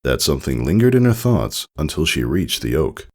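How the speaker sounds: usual speaking voice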